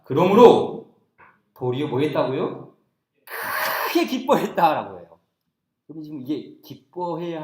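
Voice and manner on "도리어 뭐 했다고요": normal talking voice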